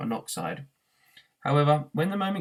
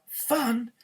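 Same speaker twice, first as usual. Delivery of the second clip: incredulous; 11 semitones higher than usual. A narrator's reading. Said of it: "yelped Arthur".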